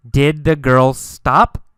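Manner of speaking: ordinary talk, nothing marked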